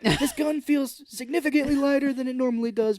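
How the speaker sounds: high, loud, crying mock threat; hammed-up desperate threat. Cage cornered by the town, threatening in a high crying voice. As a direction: overact a tearful desperate threat. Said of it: "and he's like".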